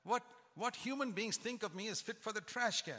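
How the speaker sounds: usual speaking voice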